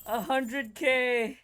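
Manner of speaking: high, loud, slow triumphant shout; excited shout. Real, not acted. Streamer cheering his huge damage number, shouting. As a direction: drag out the number in delight.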